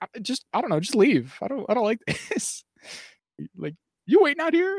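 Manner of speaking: can't find the words, creeped out; mock freaked-out officer. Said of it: "she herself seems quite freaked out by it".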